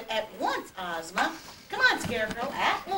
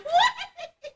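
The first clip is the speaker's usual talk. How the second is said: high gleeful giggle; 13 semitones higher, 4 dB louder than usual. Acted. Scraps giggling over the new book, high.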